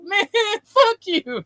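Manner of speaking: high, slow, laughing put-on retort; mock-indignant brother. Brother snapping back at the driver, laughing and indignant. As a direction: throw it back at him half laughing. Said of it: "He's like".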